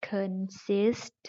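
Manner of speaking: consist slowly, ending in audible s and t; both final consonants sounded. Shown as a demonstration. Teacher demonstrates the double ending, consist with crisp s-t. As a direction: finish consist with a clear hiss then t. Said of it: "s แล้วก็ทั้ง t".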